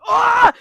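loud, high yell of horror; long high-pitched "aah" of fright; mock scream. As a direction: let out a big reenacted scream. Speaker's scared reaction to the film.

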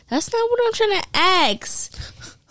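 high, drawn-out retold complaint; exasperated protest. Student retells protest to teacher.

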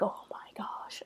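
very soft, whispered shock; awed whisper. Acted. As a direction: whisper it as if stunned by what just appeared. Hushed reaction to a scary sight.

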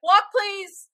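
high, sing-song usher call; mock usher call. Telling teenagers in the hallway, mock usher call. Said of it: "and being like".

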